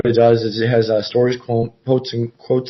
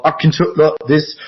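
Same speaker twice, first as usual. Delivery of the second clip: slightly higher put-on voice; changed voice demo; 4 semitones higher, 3 dB louder than usual. Shown as a demonstration. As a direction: shift into another voice, pitched up a little. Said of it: "My voice kinda takes on a life of its own".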